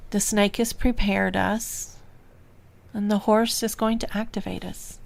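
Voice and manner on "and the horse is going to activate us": usual speaking voice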